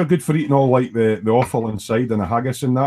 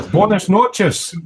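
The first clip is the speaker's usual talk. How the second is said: mock Spanish greeting; 6 semitones higher, 3 dB louder, much slower than usual. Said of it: "See, I can speak Spanish".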